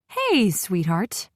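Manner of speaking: slow, fond motherly greeting; a mother answering the phone cheerily; happy mom. Acted. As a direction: greet your daughter fondly and slowly. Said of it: "her normal happy self".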